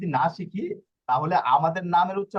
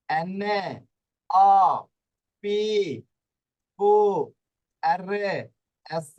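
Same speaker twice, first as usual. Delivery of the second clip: slow alphabet recital; 3 dB louder, much slower than usual. Shown as a demonstration.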